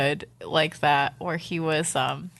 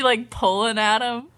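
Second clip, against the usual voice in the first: normal talking voice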